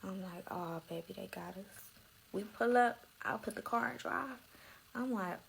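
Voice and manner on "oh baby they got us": soft, hushed, sinking realization; hushed dread. Retold or imitated. To her man at the police-filled driveway, a hushed dread.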